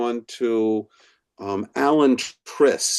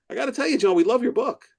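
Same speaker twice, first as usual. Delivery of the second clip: much higher pitch, no added volume; acted enthusiasm; 12 semitones higher, much faster than usual. Attorney tells the author the band's verdict, high and enthusiastic. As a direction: deliver good news in a high, eager voice.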